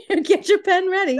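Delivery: high, laughing tease; real laughter while teasing. Real, not acted. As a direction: giggle the tease out.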